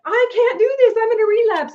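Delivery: high, loud imitated meltdown over the paperwork; panicked outburst. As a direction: throw the papers down and blurt it out in mock panic. Retelling friend's panicked outburst.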